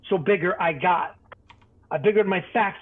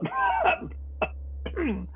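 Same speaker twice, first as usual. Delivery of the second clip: wheezy cough; 6 dB quieter than usual. Acted. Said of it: "pardon my cough".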